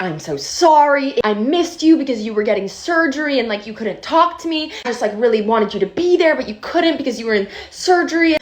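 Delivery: mock blubbering like a boyfriend caught cheating; retelling the ex sobbing his sorry; imitated bawling. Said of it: "he just starts bawling".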